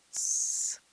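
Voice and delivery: sharp unvoiced hiss; a snake's angry tss; short angry hiss. A narrator's reading. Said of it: "hissed Gollum".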